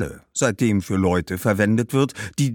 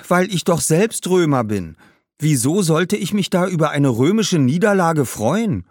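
put-on higher, indignant boy's voice; protesting young boy; 8 semitones higher, 4 dB louder than usual. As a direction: push back at the ghost, high-pitched. (protestierender Junge, höhere Stimme)

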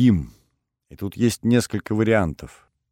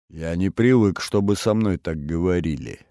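ordinary delivery

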